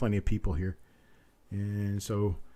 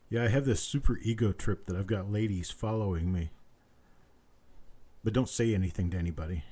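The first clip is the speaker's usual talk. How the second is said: ordinary delivery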